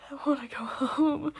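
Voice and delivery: slow tearful crying plea; through tears. A narrator's reading. Frightened narrator begs to go home, crying. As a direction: sob it out. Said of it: "I said through tears".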